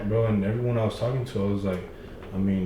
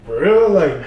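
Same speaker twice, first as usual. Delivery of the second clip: much higher and louder, mock shock; shocked disbelief; 7 semitones higher, 12 dB louder, slower than usual. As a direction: squeal of disbelief at the insult. Retelling his shocked reaction.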